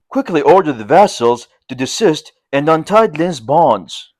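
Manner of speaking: ordinary delivery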